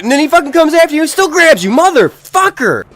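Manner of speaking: loud, high, fast furious rant; exasperated rant. Real, not acted. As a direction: rage at the zombie that won't die. Furious rant about unkillable zombie.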